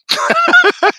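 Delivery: a big high burst of laughter; real loud laugh. Real, not acted. Laughing after the punchline.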